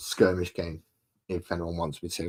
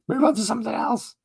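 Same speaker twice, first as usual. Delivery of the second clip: very high, loud squeaky plea; high-pitched comic whine of complaint; mock viewer heckle; 5 dB louder than usual. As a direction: imitate a fed-up viewer in falsetto. Voicing a fed-up viewer.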